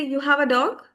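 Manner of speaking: DOG swept up high at the end; questioning intonation. Shown as a demonstration. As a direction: raise your voice on "dog" to make it a question. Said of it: "makes it sound like a question".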